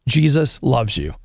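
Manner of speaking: ordinary talk, nothing marked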